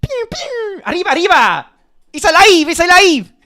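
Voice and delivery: very high, loud cartoon Mexican accent; hammed-up Mexican squeal; mock Mexican accent. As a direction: shout it like Speedy Gonzales. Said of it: "this is gonna be so offensive".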